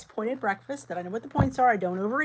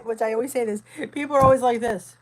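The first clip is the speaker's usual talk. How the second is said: ordinary talk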